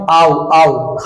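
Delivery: ordinary talk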